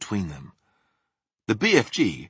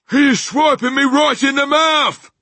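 very high, loud giant's complaint; indignant full-voiced scream; outraged yell; 9 dB louder than usual. A narrator's reading. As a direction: yell it as if just struck. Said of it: "yelled the meat dripper".

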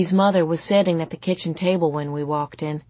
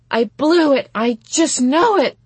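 high, loud despairing wail; dramatic lament, pitched way up; 9 semitones higher, 7 dB louder than usual. A narrator's reading. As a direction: wail it like a distraught teenager. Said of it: "she wailed".

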